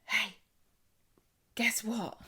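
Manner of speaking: confidential, half-whispered announcement; acted hushed tip-off; imitating Craig. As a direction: let a listener in on a secret. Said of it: "it's followed by Craig, who's like".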